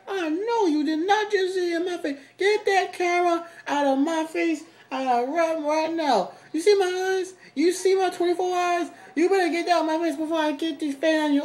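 high, loud angry spider rant; a tiny furious spider yelling at the camera. Pretend. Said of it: "She's like".

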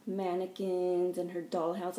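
ordinary talk, nothing marked

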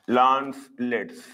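LANCELETS slow, split into lance and lets; slow repetition. Shown as a demonstration. Teacher repeating the common name for students, slow and separated. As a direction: draw out "Lancelets" in two separate parts.

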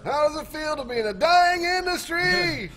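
loud, high drawn-out holler; mock-enthusiastic taunt. Taunting mock-singing game commentary.

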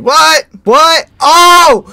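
loud, high startled yelps; real shock. Real, not acted. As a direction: yelp in alarmed disbelief. Fake error screen fools him.

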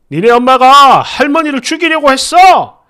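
mock furious father yelling; imitating angry yell. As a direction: shout the accusation at the kid. Husband yelling at child.

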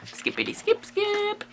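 high, soft, slow singsong; tiny high voice, skipping along lightly; playful filler. Pretend. Playful filler while turning pages.

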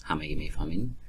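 usual speaking voice

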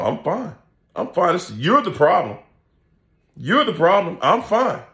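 a hint of a whiny denier; mock narcissist. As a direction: mimic a defensive narcissist brushing it off. Mocking the narcissist's denial.

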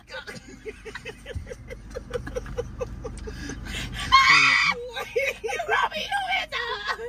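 high screaming laughter; shrieking laugh. Real, not acted. Bursts out after punchline.

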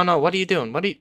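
ordinary talk, nothing marked

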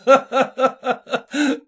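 a high, hearty burst of laughter; guffaw. A narrator's reading. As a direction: roar with laughter at the mix-up. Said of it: "захохотал я".

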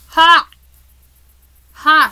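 loud, high triumphant ha; triumphant laugh. Real, not acted. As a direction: bark a triumphant ha. Triumphant bark.

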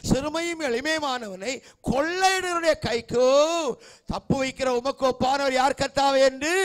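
scripture cried out, building to a high peak; rousing pulpit declamation; full-force preaching. Acted.

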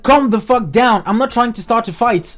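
heated retort. Retold or imitated. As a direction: snap back forcefully in a retold argument. Retold argument with angry boyfriend.

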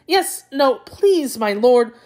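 flustered pleading. Acted. Jackal placating the impatient tiger, flustered pleading. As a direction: stammer a high-pitched, flustered plea.

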